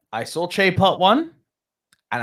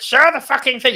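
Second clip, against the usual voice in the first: higher, louder mock demand from fans; impatient crowd demand; 11 semitones higher, 7 dB louder than usual. Fans demanding to see the monster, mock yell. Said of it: "People were like".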